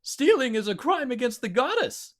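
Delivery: squeaky high yip; yipping. Acted. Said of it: "Steve yipped".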